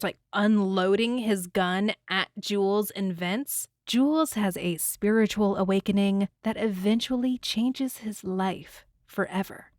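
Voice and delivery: usual speaking voice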